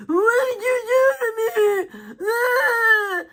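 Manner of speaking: high, slow mock wail; mocking her voice. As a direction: drag the words out in a loud, high crying imitation. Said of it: "just listen to her. She's like".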